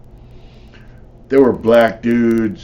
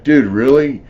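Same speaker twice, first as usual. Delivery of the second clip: higher, louder disbelieving dude really; incredulous; 5 semitones higher, 7 dB louder than usual. Retold or imitated. Retelling the fight to a listener, incredulous protest. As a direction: sound amazed he'd fight that dirty.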